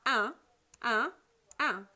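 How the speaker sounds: "Un" as an isolated nasal sound, repeated with pauses; phoneme demonstration. Shown as a demonstration. Teacher asks pupils to pronounce along, isolated "Un" with emphasis. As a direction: model "Un" for repetition, each one separate. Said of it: "Prononcez avec moi".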